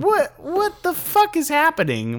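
high, slow, incredulous mock cry; retold bewilderment. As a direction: act out total bafflement, stretched and high. His baffled reaction to salesman acted out.

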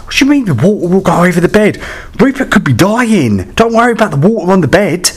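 high, fast exasperated panic; frantic retort. Retold or imitated. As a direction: fire it back at him in a panic. Panicked retort to calm husband.

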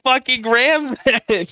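high, squeaky, incredulous outburst; outraged disbelief. Real, not acted. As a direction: shriek it in horrified disbelief. Learns the Ram won't fit.

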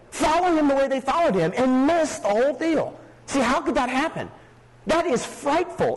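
a hint of preaching at full force; impassioned preaching. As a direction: drive it home in disbelief. Preacher builds to passionate point.

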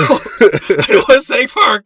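slow, high laughing yell; laughing shout. Real, not acted. Laughing shout after story.

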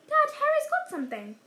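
high-pitched, loud tattling cry; sudden outburst. A narrator's reading. Son telling dad on Harry, sudden outburst. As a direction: blurt it out as a child telling on someone. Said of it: "said Dudley suddenly".

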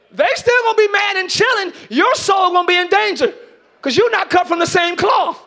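loud, high, slow preaching cry; full-force preaching. Acted. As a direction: preach it out to the back row, stretched long.

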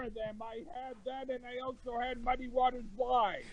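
far from mic. Real, not acted. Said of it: "You still sound all muddy".

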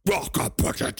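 mock Klingon with heavy emphasis; harsh Klingon emphasis. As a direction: spit it out like a Klingon warrior. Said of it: "they really put some emphasis into it".

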